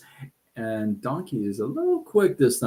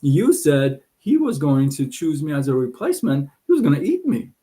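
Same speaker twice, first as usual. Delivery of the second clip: much higher, whiny donkey voice; 5 semitones higher than usual. Acted. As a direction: play the cheated donkey up high. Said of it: "The donkey says".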